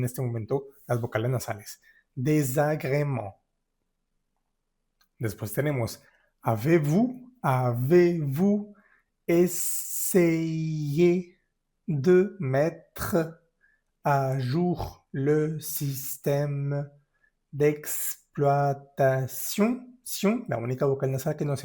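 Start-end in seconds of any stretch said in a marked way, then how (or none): 2.1-3.3 slow Désagrément ending on a nasal vowel; Désagrément spoken slowly, final nasal vowel held; nasal last syllable. Shown as a demonstration. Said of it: "Lo que me interesa es la última sílaba". 6.4-19.8 very slow, each chunk separated by pauses; slow dictation pace; slow syllable-by-syllable reading. Shown as a demonstration. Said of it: "teacher reading aloud slowly".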